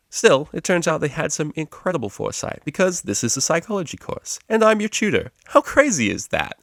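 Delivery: loud, upbeat lecturer greeting the class; cheery tutor. Acted. Tutor Psych introduces himself, loud and chirpy.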